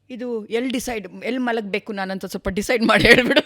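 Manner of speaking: lightly acted exasperated plea; mock complaint. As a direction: build to a loud finish, tipping into laughter. Voices husband's bedtime complaint.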